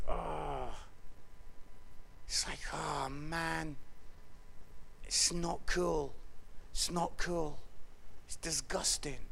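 low, soft, disgusted murmur; dismayed. Acted. Preacher imagining his sins shown on screen, low and dismayed. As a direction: let it sink low, with pauses, in shame.

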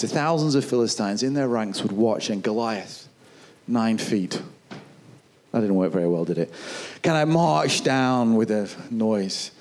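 ordinary delivery

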